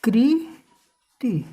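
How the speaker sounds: कृति split into a long कृ, then ति; very slow sounding-out of कृति; syllable by syllable. Shown as a demonstration. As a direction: draw out कृति with a gap inside. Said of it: "फिर ये".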